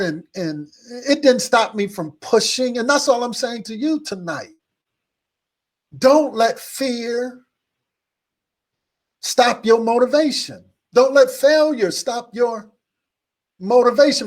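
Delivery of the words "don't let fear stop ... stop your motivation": slow, deliberate sermon emphasis, each word weighted; preaching emphasis. Acted. Preacher to the congregation about fear, slow and weighted. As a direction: lean on every word to drive it home.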